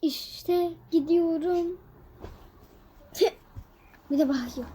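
ordinary talk

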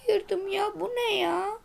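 normal talking voice

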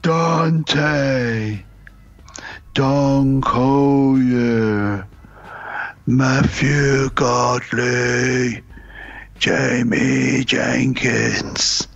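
slow drawn-out zombie groan. Pretend. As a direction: moan the names like the undead. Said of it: "in my best zombie voice".

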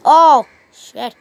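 sudden loud oh shit at the game; loud curse. Real, not acted. Gamer cursing at his screen, loud. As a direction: blurt it out in alarm. Gaming mishap outburst.

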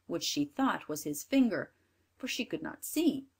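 ordinary delivery

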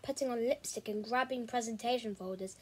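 ordinary delivery

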